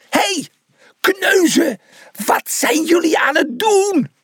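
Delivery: high, furious yell; angry bellow. A narrator's reading. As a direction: roar it across the field. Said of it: "brulde hij".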